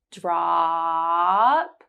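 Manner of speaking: "Drop" with the ah held three seconds. Shown as a demonstration. Teacher holding the ah in "Drop" for learners. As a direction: stretch the ah in "Drop" long. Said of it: "hold ah for three seconds for the first time".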